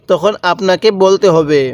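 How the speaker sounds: ordinary delivery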